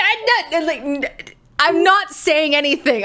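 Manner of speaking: high, rushed protest; fumbling to back out quickly; flustered, tripping over words. Real, not acted. Flustered after interrupting.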